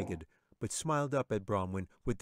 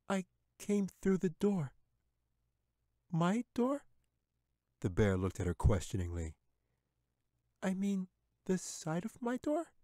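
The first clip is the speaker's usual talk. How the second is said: put-on high, polite girl's voice; timid little girl; 11 semitones higher than usual. A narrator's reading. Katy answering the bear, high and timid.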